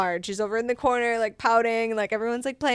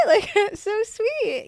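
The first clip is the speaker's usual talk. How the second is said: laughing; 11 semitones higher, 5 dB louder, much slower than usual. Real, not acted. Laughing while gushing.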